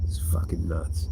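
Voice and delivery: breathy whispered aside under the breath. Real, not acted. To viewers about the jail guards, a whispered aside. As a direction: drop to a whisper, half to yourself.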